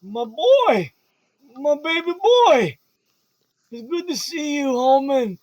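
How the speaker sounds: put-on high, slow, doting old father; old dad voice. Old dad tenderly greeting his son Hallman, high doting voice. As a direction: coo over your long-lost son. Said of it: "he puts his hand up to you, Hallman, goes".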